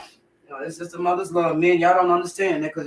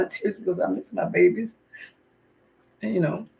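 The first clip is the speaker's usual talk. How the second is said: tearful; 3 semitones higher than usual. Real, not acted. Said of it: "big old crocodile tears".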